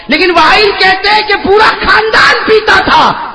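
high, loud, slow preacher's cry; fervent sermon shout; preaching at full force. Acted. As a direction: lift the crowd with it. Preacher builds to climax.

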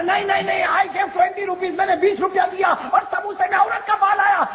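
high, shouted comic insistence; stubborn protest. Acted. Customer turning stubborn with the samosa vendor, high protest. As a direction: dig in, very high and unyielding. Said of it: "وہ تو ضد پہ آ گیا".

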